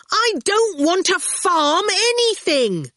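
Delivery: high, slow, emphatic protest; protesting shout. A narrator's reading. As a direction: shout a vehement denial. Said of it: "Howard shouted".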